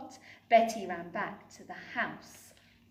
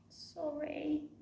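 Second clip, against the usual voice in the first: sorry cry; 6 semitones higher, 7 dB quieter than usual. Acted. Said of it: "cried Betty".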